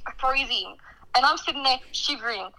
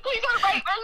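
high-pitched imitation of a crying plea; retold tearful plea; 10 semitones higher than usual. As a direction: replay the tearful begging in a raised pitch. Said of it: "I started crying".

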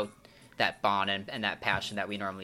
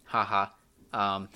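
ordinary delivery

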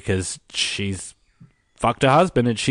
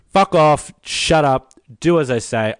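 louder, snapping orders; mock angry director; 4 semitones higher, 4 dB louder than usual. Director dismissing the women, a loud mock snap. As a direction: bark it like a bossy director.

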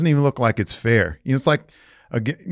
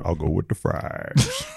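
slow, drawn-out celebrity impression; lower, unhurried film-star voice; Morgan Freeman; 4 semitones lower, 4 dB quieter, slower than usual. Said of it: "Is this your Morgan Freeman impression?".

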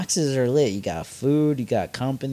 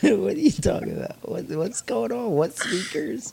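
high, giggling questions; laughing while talking; 9 semitones higher than usual. Real, not acted. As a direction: ask while cracking up. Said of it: "Why is that so funny".

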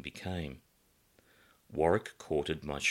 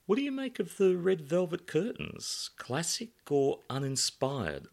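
lightly voiced narrator character; 12 semitones higher than usual. Alan voiced in first-person story.

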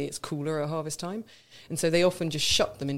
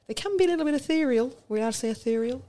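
high airy voice; 9 semitones higher, faster than usual. Acted. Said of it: "we've got another impression now".

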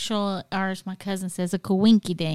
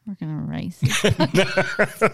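laughing; 3 dB louder, much slower than usual. Real, not acted. Joking while laughing.

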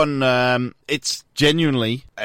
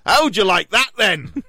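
higher, slow mock defiance; acted taunt; 6 semitones higher, slower than usual. News reader voicing the arrested man to officers, taunting. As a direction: throw it out as a cheeky challenge.

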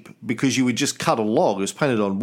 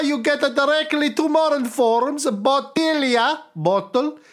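bad Italian accent; 10 semitones higher than usual. Reading a listener's letter to the cohost in a mock Italian accent. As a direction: read the listener's email in a comic Italian accent. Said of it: "trying to think of what my Italian accent".

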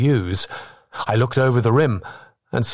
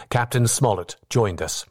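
ordinary delivery, nothing marked

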